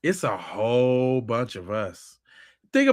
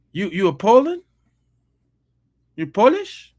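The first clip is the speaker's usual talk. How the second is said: higher, louder, rising disbelief at "Polish"; can't believe this guy, repeat it up high; mock shock at a Polish commenter; incredulous mock disbelief; 6 semitones higher, 6 dB louder than usual. Real, not acted. Mocking foreign commenter.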